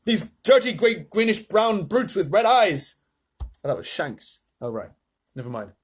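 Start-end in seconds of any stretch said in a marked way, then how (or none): 0.1-3.0 loud, higher radio shout; shouting astronaut. A narrator's reading. Shuckworth radios the president in panic, loud. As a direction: yell it over the din.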